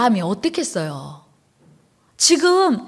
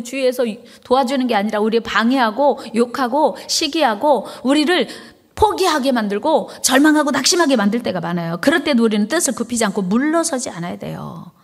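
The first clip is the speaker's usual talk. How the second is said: ordinary delivery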